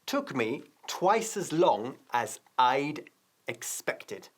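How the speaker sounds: very slow, each word spelled out; slow model sentence. Shown as a demonstration. Teacher slowly modelling the correct English sentence.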